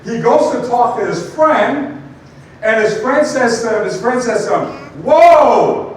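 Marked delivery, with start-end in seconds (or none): 5.0-5.9 high, loud whoa; big mock whoa. Friend cutting off an excited newcomer, loud whoa. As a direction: stop him in his tracks.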